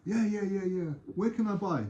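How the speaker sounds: high, eager tourist voice; acted tourist. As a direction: play the keen visitor wanting to buy. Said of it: "He's a tourist".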